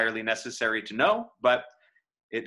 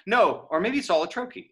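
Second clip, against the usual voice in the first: ordinary talk, nothing marked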